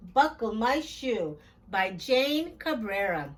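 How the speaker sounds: usual speaking voice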